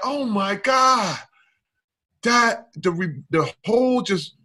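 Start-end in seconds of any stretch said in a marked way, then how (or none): 0.0-1.2 higher, slow shouted Oh my God; overwhelmed by his wife's acceptance, shouting in awe; amazed. Retold or imitated. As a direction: cry it out in astonishment. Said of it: "Now I was like".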